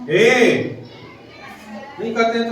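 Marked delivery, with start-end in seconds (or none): 0.1-0.6 हे stretched out long like calling someone; long calling hey. Shown as a demonstration. Teacher shows students a call, हे held long. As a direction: drag out हे as if calling across the room. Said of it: "हे जब कहते हैं तो समय लग रहा है ना".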